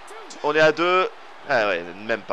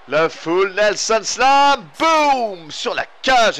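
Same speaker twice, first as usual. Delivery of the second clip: higher, louder, slow drawn-out call; excited shout; 6 semitones higher, 5 dB louder, much slower than usual. Real, not acted. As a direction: hammer the impact home. Slam onto cage. (cri excité sur l'impact)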